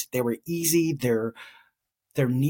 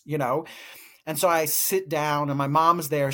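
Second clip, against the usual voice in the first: usual speaking voice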